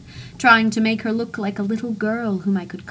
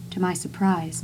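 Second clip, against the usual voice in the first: plain reading voice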